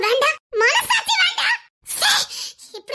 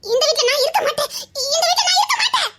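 crying it out, the repeat pitched higher; sobbing refusal; 4 semitones higher than usual. Acted. Wife refusing to stay in the house, crying. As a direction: break down as you insist you will leave.

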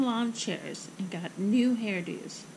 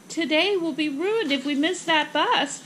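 higher-pitched, urgent burst; urgent warning; 5 semitones higher, 4 dB louder than usual. Acted. Mother fears missing the bus, urgent warning. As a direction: hurry her along, voice raised.

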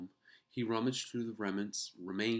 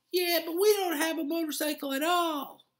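high, loud squeaky retort; small mouse voice; 12 dB louder than usual. A narrator's reading. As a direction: answer back in a tiny squeak.

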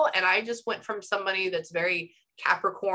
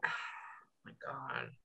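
sighed, hushed and lower oh my God; breathy awed murmur; 17 dB quieter than usual. Real, not acted. Psychic overwhelmed by the client's energy, sighing it hushed. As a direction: let it out on a sigh, almost to yourself.

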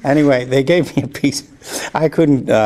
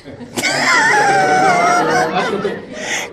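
big laugh. Real, not acted. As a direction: laugh out hard at his own joke. Joke about his own idiocy.

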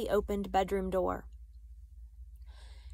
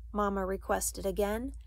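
ordinary delivery

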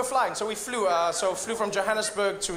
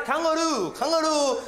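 higher-pitched, stubborn repeated answer; insistent traveller; 6 semitones higher than usual. Acted. Traveller stonewalling the border officer, insistent mock voice. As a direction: play the uncomprehending traveller, pitched up. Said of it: "There's a guy ahead of me".

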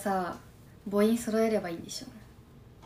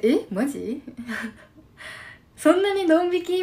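mock shocked reaction; 7 semitones higher, 5 dB louder than usual. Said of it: "みたいなリアクション取るっけ？".

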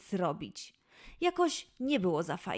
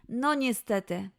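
usual speaking voice

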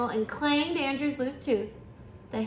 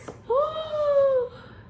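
high, drawn-out gasp; long high gasp of mock shock; 14 semitones higher than usual. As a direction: gasp at a storybook disaster. Narrator reacts to car falling apart.

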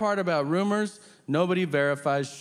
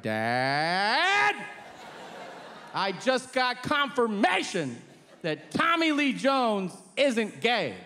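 mock grand announcement; 5 semitones higher, much slower than usual. Breaking big news to dad.

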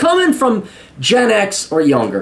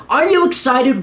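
ordinary delivery

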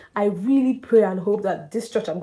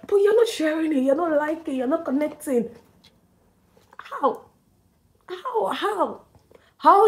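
exasperated; 7 semitones higher than usual. Real, not acted. Scolding viewers for not sharing.

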